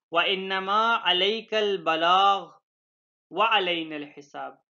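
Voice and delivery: very slow Quranic recitation, long held vowels; verse recitation. Real, not acted. Teacher quoting God's word from the Quran, slow recitation. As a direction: recite the Arabic slowly and deliberately.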